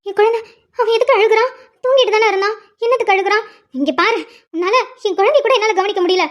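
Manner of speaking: high-pitched, agitated complaint; upset mother. Acted. Mother upset over crying child. (உயர்ந்த குரலில் பதற்றமான புலம்பல்)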